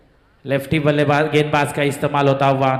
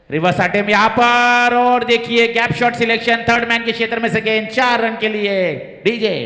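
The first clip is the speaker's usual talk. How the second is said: excited cricket commentary; 5 semitones higher than usual. Real, not acted. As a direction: lift the voice for the boundary. Boundary hit, commentator rises.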